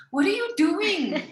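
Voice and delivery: high, loud mock disbelief; incredulous. Daughter asks her singing dad what he's doing, high and incredulous. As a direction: squeal it out in disbelief. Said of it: "And I'd be like".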